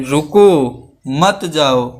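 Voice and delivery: "रुको" rising, clear pause, then "मत जाओ"; comma after रुको. Shown as a demonstration. Teacher showing how a comma changes meaning, pause after "रुको". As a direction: break after "रुको", keep "मत जाओ" together. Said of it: "यहाँ पे अगर कामा लगा दिया".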